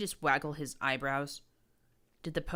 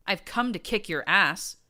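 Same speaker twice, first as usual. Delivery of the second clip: higher, louder battle yell; defiant scream; 4 semitones higher, 8 dB louder than usual. A narrator's reading. Narrator screaming defiance at the prince. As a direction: yell it as you swing. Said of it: "I screamed".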